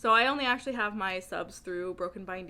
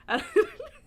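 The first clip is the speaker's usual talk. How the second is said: high burst of laughter; 8 dB louder than usual. Real, not acted. Laughing at her own retelling.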